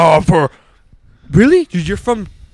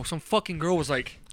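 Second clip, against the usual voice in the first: ordinary talk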